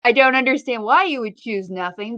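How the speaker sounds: ordinary talk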